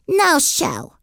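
put-on high little-kid voice, babyish; toddler voice. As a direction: play a stubborn toddler refusing. Toddler Fudge refuses mother.

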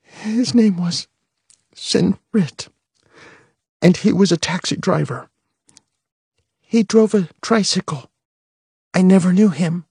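holding back tears; child reciting the story, still shaky. A narrator's reading. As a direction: push the words out, steadier. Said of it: "without crying too much".

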